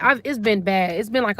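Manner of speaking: ordinary delivery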